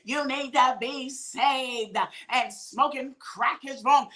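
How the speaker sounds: slow, drawn-out mock admonishing; preachy mock scolding. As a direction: lay it on slowly, like a finger-wagging saint. Acting out blunt preachy confronter.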